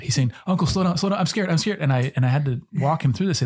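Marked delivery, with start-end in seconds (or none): 0.5-1.8 imitating scared nephew. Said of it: "And he is terrified".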